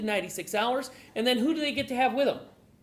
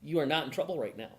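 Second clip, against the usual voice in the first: reassuring officer; 6 semitones lower than usual. Retold or imitated. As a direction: talk him down gently like a cop. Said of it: "he may look at Wayne and go".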